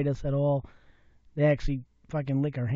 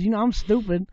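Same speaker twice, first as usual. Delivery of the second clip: usual speaking voice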